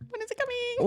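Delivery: high, squeaky mock worry; mock anxious waiting. Imagining waiting in the basement for a tornado, mock anxious. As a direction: pitch it way up, mock-fretful.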